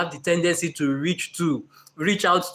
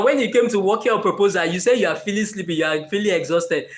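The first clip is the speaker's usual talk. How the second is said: ordinary talk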